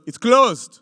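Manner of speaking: loud, curt order; soldier's curt order. Retold or imitated. Soldier at the Temple Mount refusing entry, loud curt order. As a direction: shut him down flat. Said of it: "He goes".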